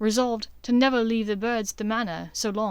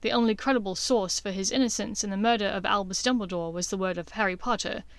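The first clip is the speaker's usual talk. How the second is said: usual speaking voice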